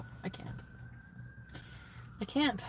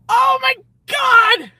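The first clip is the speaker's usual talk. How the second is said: high, loud, drawn-out shriek; embarrassed shriek; 12 dB louder, much slower than usual. Real, not acted. Mortified by her own joke, loud shriek.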